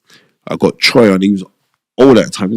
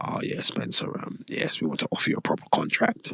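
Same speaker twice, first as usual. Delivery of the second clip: whispered offer; 8 semitones higher, 16 dB quieter than usual. Retold or imitated. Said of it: "He's like".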